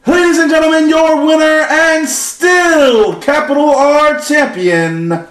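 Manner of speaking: high, loud, slow ring announcer call; booming pumped announcer. Pretend. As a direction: draw out the winner like a ring announcer.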